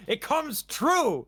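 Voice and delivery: slow, high triumphant cry; excited. Real, not acted. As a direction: announce it like a prophecy. Gleeful exclamation.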